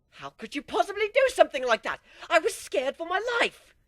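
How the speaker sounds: furious outburst. Acted. Pony yelling at the captain who faked the scare. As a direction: shout it at him, scared and outraged.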